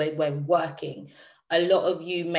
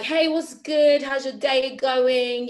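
high, loud, slow, sing-song put-on cheerfulness; fake sweet cheer; 8 semitones higher, 5 dB louder, slower than usual. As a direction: act breezy and bright as if all is fine. Answering boyfriend's call, putting on cheer.

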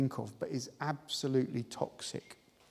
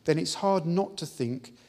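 louder, ringing preacher's declaration; full-force pulpit preaching; fervent preaching; 2 semitones higher, 5 dB louder than usual. Acted. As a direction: proclaim it to the congregation.

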